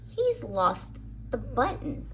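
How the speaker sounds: ordinary delivery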